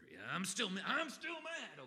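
hammed-up shout, pitch leaping up high; building mock fury, high and loud; mock angry. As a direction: work yourself into a silly shouting rage. Grudge-holder working himself up.

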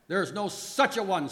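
Death screaming back, high and loud; high, loud dramatic cry; screamed retort. A narrator's reading. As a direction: scream the denial in theatrical rage. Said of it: "Scream death".

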